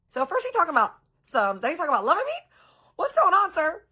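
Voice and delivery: high, loud disbelief at his mood swing; incredulous. Real, not acted. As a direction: throw your hands up in outraged disbelief. Incredulous aside to viewers.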